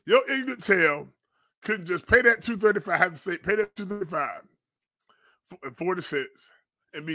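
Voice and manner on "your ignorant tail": normal talking voice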